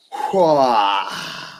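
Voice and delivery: big drawn-out exhale, falling in pitch; long deliberating sigh. Real, not acted. Host stuck choosing who goes down, deliberating sigh. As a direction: puff out a long breath while deciding. Host weighing his relegation pick.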